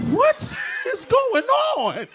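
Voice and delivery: high, loud, drawn-out disbelief; baffled outburst. Acted. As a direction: shout it slowly in comic bewilderment.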